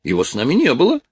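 high, suspicious character voice; put-on high voice, puzzled and affronted; indignant Polish officer. Strus goes on about the stranger.

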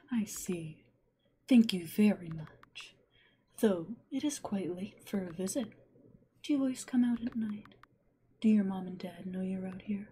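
hushed, gentle witch; whispering witch. Acted.

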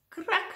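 high, sudden cracking noise; sharp high-pitched crack; twig crack. A narrator's reading. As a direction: snap the word like a breaking twig. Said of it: "zei het takje".

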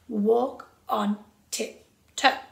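"walk on tiptoe" very slow, evenly spaced; four even beats. Shown as a demonstration. Teacher demonstrates the four crotchets, slow even beats. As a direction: give each beat of "walk on tiptoe" its own crotchet. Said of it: "they are our four crotchets".

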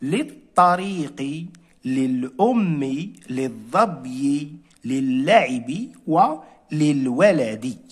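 each word read slowly with a pause after it; slow dictation-style reading of the word list; slow careful list. Shown as a demonstration. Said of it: "الكلمات اللي حصلنا عليها".

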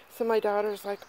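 ordinary delivery

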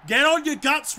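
ordinary delivery

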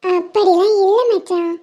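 put-on a tiny girl promising to stay; sweet child reassuring her friend; little girl voice.

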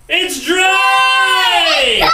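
high, loud celebratory yell; big rallying shout; triumphant shout. Real, not acted. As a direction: cheer it out at full volume. Triumphant announcement, all together.

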